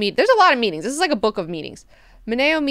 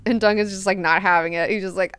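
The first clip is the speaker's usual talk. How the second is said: ordinary talk, nothing marked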